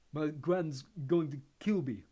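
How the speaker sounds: slow, thick voice through a bloody nose; thickly. A narrator's reading. Neville, nose bleeding, mourns his broken wand, thickly. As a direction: speak as if your nose is bleeding. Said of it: "said Neville thickly".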